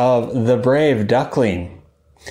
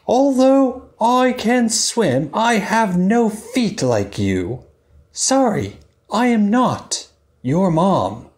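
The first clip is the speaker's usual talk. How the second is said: high, kindly apologetic fish; gentle fish voice; 6 semitones higher than usual. Acted. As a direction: let the little one down gently. Story voice for the fish.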